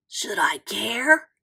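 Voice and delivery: slow whispered reply; barely bother to answer, whispering; weak whisper. A narrator's reading. Sick Joey answering his pushy friend, whispering.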